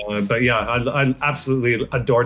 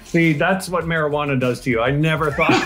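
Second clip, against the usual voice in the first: ordinary delivery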